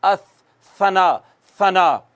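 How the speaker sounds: सना with a soft, unhissed s; lisp-free gentle सना; soft s without whistle. Shown as a demonstration. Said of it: "नरमी से".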